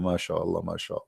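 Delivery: low, very soft mock praise; sarcastic mutter. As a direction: murmur it under your breath mockingly.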